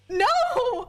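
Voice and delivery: high, drawn-out mock-horrified no; emphatic denial. Grandchild denying it to grandma, emphatic denial.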